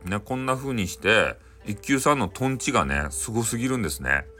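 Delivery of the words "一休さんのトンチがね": plain reading voice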